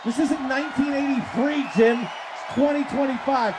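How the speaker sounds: ordinary talk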